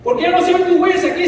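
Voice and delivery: man crying out to the Lord about the missing footprints, desperate. Retold or imitated. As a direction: fire the question again in anguish. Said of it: "el hombre le dice".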